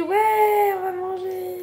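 voix aiguë, pleurnicharde pour rire; faux pleurs. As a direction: geindre comme en larmes, pour de faux. Pleure de joie pour manger. (pleurnicherie aiguë exagérée)

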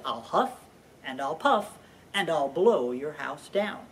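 ordinary talk, nothing marked

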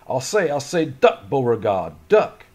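high, loud Southern officer shout; Confederate soldier impression. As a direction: ham up a rowdy Southern officer. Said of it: "my bad impression of a Confederate soldier".

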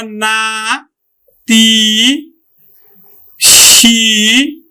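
না, তি, সি each held long and separate; slow sounding-out of না, তি, সি; syllable-by-syllable demonstration. Shown as a demonstration. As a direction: stretch না, তি, সি with pauses between. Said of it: "উচ্চারণ করি কিন্তু".

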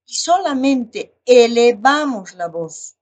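loud, slow, heavily stressed "elevamos la voz". Shown as a demonstration.

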